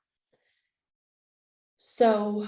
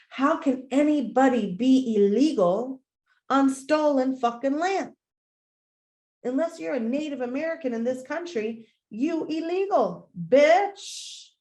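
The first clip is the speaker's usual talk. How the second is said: higher, louder, fired-up rant; heated rant; 5 semitones higher, 4 dB louder than usual. Real, not acted. Ranting at exclusionary neighbors, loud and high. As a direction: let the outrage climb to the last word.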